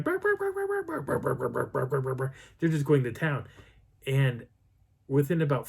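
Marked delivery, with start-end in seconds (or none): 0.0-2.3 imitated growly barking. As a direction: mimic dogs barking and growling. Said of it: "they're growling, starting".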